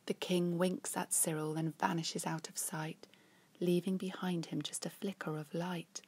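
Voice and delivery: lively read-aloud storytelling; reading a rhyming story to children; story narration. A narrator's reading. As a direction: tell it like a bedtime picture book. Picture book read aloud.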